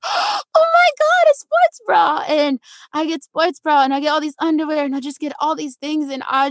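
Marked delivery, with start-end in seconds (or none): 0.0-2.2 gasp, then very high drawn-out squeal; overjoyed realization. Retold or imitated. Kid asked what she wants herself, overjoyed. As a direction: re-enact a kid thrilled at a longed-for treat. Kid's delight at own choice.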